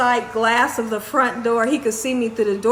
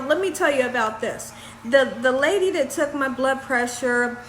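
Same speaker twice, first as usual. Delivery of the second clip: usual speaking voice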